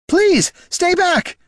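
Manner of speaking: very high, loud, drawn-out plea; desperate stretched cry; terrified pleading. A narrator's reading. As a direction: beg them to keep away in panic. Terrified man begs corpses to halt.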